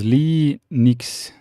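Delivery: Linux drawn out as LEE-nix; Linux with a long "lee" and "nix" ending; pronunciation from chat. Shown as a demonstration. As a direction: say Linux slowly in two parts, LEE, nix. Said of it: "Ahmed says".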